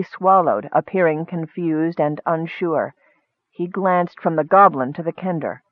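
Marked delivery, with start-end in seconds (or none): none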